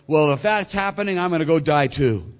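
loud, high, blustering vow; bold declaration. Acted. Peter vowing to follow Jesus, bold and loud. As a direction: blurt it out with hammed-up bravado.

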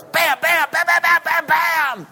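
high, loud volley of shouted bams; rapid-fire outburst. Retold or imitated. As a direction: fire off bams like a blazing tirade. Son's outburst imitated as gunfire.

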